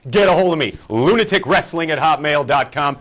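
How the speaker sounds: usual speaking voice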